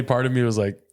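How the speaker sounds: ordinary talk, nothing marked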